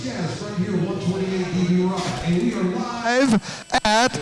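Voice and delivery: station ID building to a high whoop; live radio announcer. Acted. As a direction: stretch the announcement, rising into a cheer.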